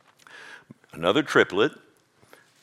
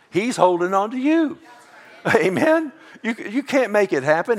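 much higher and louder, preaching at a peak; fervent high exclaim; 10 semitones higher, 5 dB louder than usual. Real, not acted. Preacher exclaiming to the congregation, high and loud. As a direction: lift it high and ring it out to the church.

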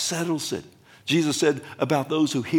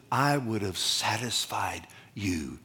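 whisper; 3 semitones lower, 4 dB quieter than usual. Acted. Quoting God in a hush.

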